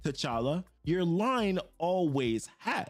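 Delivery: slow, heavy, drawn-out pronouncement; slow menacing harbinger, every word weighed; ominous cosmic entity. Retold or imitated. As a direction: speak like an ancient power claiming a king. Progenitor voice held.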